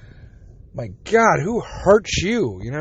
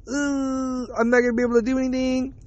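higher, put-on helpless groan; mock pained whine; 5 semitones higher, 4 dB quieter than usual. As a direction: whine it like you're stuck and hurting. Acts out his pained, helpless self.

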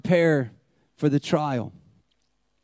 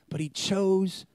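hushed awe; 5 dB quieter, slower than usual. Real, not acted. Sermon's emotional climax.

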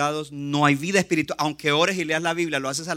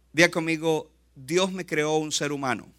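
ordinary delivery

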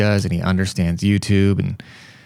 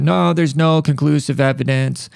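higher, drawn-out imitation of a doctor; dismissive doctor; 6 semitones higher than usual. Doctor repeatedly brushing off a patient, mildly mocking imitation. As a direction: slowly mimic a doctor's stock refusal. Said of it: "So he kept, um, telling me that".

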